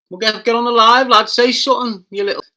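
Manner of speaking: high, loud, goading challenge; loud taunt. Real, not acted. Streamer goading a troll to join the live, loud and high. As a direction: dare him to come on the live.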